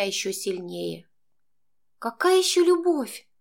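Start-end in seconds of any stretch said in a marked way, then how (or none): 2.0-3.3 high, indignant outburst while struggling; furious retort. A narrator's reading. Girl fighting to break free from Max, furious retort. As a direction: throw it back at him, much higher. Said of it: "процедила я, яростно вырываясь".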